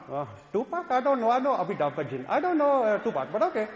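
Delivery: lightly voiced Indian-accented mom, puzzled; a hint of a bemused Indian mother; mom's Indian accent. Mother answering her son about [name], light Indian accent. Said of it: "min mor".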